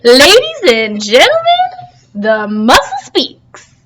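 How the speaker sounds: slow, grand announcement; announcer. Acted. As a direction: proclaim it like a ringmaster. Announcing like a showman.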